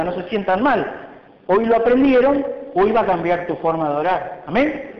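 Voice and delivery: ordinary talk, nothing marked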